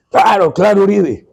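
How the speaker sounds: imitating an emphatic go-ahead; retold eager assent. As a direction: quote him agreeing heartily, voice lifted. Acts out president's eager reply.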